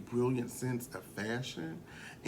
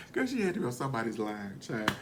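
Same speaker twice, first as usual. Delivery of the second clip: normal talking voice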